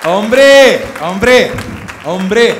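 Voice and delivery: loud, high proud cheer; proud shout for the Canary accent; cheering ole. Acted. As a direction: belt out the ole like a fan at a bullfight. Comic hails the Canary accent.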